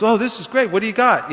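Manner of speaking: a hint of an eager guy at the door; eager neighbor. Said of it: "he goes".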